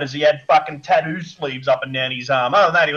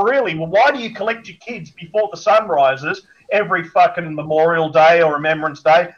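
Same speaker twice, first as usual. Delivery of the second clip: ordinary talk, nothing marked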